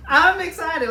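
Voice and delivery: high, loud, laughing cries of joy; ecstatic squeal. Real, not acted. Thrilled vlogger opening the steel set, squealing in delight. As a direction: scream it like the best gift ever.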